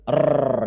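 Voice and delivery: "R" as a strong trilled Indonesian r; rolled r. Shown as a demonstration. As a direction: roll the "R" hard. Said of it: "mesti ada, ada bunyi getar". (R bergetar kuat ala Indonesia)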